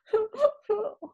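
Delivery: high hammed-up crying wail; high mock frog wail. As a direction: wail like a tiny heartbroken frog. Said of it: "began to wail".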